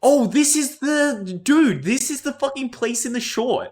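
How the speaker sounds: high, loud and drawn out, thrilled to recognize it; excited recognition. Real, not acted. Gamer thrilled to recognize the place from the animated short, shouting excitedly. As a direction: shout it out, delighted to spot the place.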